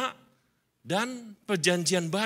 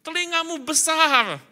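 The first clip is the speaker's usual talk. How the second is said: mock book advice; 7 semitones higher, 3 dB louder than usual. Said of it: "ada buku lama, cerita".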